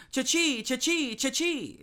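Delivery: high chirping call, each fall; chirping nickname. Retold or imitated. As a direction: sing it out like a flock of sparrows calling. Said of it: "A hundred thousand people chirping cha-chee".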